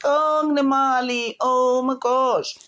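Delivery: high, slow, snooty drawl; mocking a lady showing off her money; snob impression. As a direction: put on a rich snob's airs. Mocking snobbish ladies.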